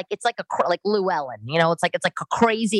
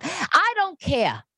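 slow, high mock-defiant drawl; 12 semitones higher, much slower than usual. Defiant reply to her girlfriend, high and slow.

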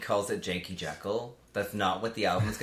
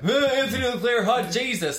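high, loud squeal; excited squeal; 9 dB louder than usual. Retold or imitated. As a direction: shriek it out with glee. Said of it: "Dana thought it was the fucking funniest".

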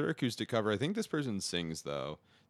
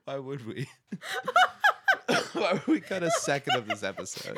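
laughing; 9 semitones higher, 8 dB louder than usual. Real, not acted. Laughing while talking.

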